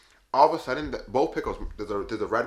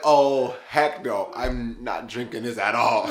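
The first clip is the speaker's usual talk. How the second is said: loud retold refusal, slowing at the end; emphatic refusal; 2 semitones higher, 8 dB louder, much slower than usual. Storyteller refusing the slush drink, emphatic. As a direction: refuse loudly, dragging out the last words.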